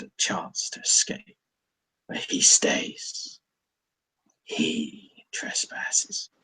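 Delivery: slow, breathy, menacing beast whisper; menacing creature whisper. Acted. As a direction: hiss a verdict low and slow like a wolf. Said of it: "turns slightly and just goes".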